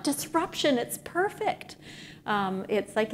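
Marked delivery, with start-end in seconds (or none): 0.0-1.8 high-pitched, thrilled exclamation; delighted outburst, pitched well above usual talk; excited. Retold or imitated. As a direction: retell it with a leap of excitement, voice lifted high. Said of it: "I'm getting more excited".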